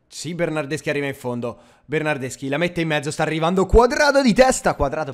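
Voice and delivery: rising, shouted football commentary; live play-by-play. Acted. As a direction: call the attack like a TV commentator. Commentary on attack.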